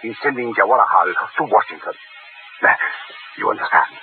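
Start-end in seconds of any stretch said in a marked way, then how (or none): 3.4-4.0 whispered. Acted. Proconsul presses in a hush.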